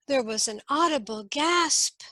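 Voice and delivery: usual speaking voice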